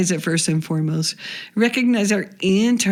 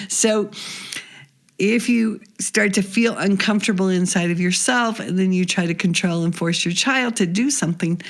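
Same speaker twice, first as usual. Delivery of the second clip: normal talking voice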